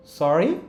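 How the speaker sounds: "সরি" rising as a question; rising one-word question. Shown as a demonstration. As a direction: ask "সরি" with a lift. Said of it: "টোনটা rise করেছে".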